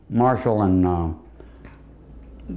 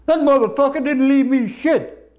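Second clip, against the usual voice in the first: very high, louder squawking widow voice; 4 dB louder than usual. Retold or imitated. Comic voices a widow complaining about her dead husband, shrill and loud. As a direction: shriek it like a cheated widow.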